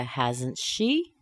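"hasn't she" rising at the end; rising intonation. Shown as a demonstration. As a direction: lift "she" into a real question. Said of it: "Okay, rising intonation".

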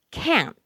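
high, loud "can't" stopped short without the t; abrupt "can't" with the final t swallowed; silent T. Shown as a demonstration. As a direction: cut "can't" off sharply, no released t. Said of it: "the T is often not pronounced".

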